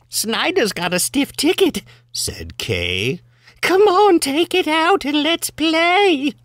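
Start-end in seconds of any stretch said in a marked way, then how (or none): 0.1-1.8 high-pitched character voice; voicing Kay. Reader quoting Kay in a limerick, high character voice. 3.6-6.3 very high, loud, eager squeal; voicing Kay. Reader as Kay urging him to play, high excited squeal.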